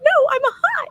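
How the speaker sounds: very high, loud mock wail; tearful protest. Overheated fighter arguing with her coach, tearful. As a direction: whine back as if crying, hammed-up. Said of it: "I'm over there and I'm crying".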